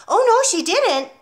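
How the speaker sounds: high, loud, eager piping; piped. A narrator's reading. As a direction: pipe it up like a keen little boy. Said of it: "piped Nigel".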